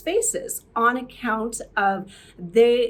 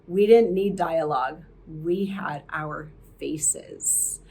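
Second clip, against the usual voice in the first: Gloria Swanson; 5 semitones lower than usual. Retold or imitated. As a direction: hand down the line like a fading diva. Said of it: "famously said".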